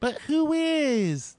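high, slow breathy-sweet impression of a girl; mocking girl voice. Mocking a reality show girl, high and slow. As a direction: parody her sappy excuses in a high voice. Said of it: "and then".

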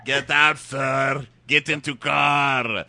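put-on loud, slow accented barking order; mock shout. Friend voicing the old man ordering him out, mock shouting. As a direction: bark orders like a gruff driver. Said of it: "He's like".

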